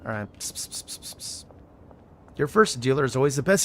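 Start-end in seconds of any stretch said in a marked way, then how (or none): 0.4-1.4 string of voiceless pssts; soft, repeated hissed psst. Pretend. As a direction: get someone's attention with hushed pssts. Calling someone covertly in game.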